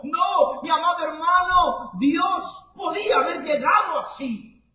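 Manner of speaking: grito de predicador, alto y agudo. Real, not acted. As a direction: clama con fuerza a la congregación. Preacher rejects the stern image. (el predicador grita a los hermanos)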